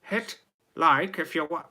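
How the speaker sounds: high, loud attempt at Scottish; bad Scottish accent. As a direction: push the voice up into mock Scottish. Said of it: "what's Sc-, Scottish?".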